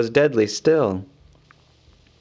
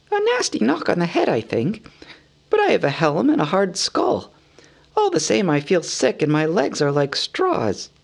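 high hobbit voice, bruised but plucky; hurt hobbit; 6 semitones higher than usual. Acted. Injured Bilbo answers the man, high, plucky and woozy. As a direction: make light of the knock, woozy.

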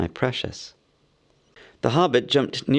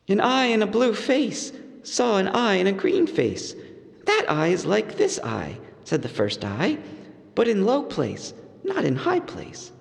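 higher, sly hobbit voice; riddling hobbit; 9 semitones higher than usual. A narrator's reading. Bilbo testing Gollum with a new riddle, proud. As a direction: pose it proudly.

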